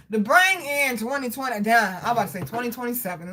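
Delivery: normal talking voice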